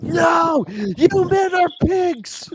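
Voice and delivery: outraged high-pitched shriek, slow and drawn out; gnarly scream. Retold or imitated. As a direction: imitate a woman screaming in disgusted fury. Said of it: "gnarly scream".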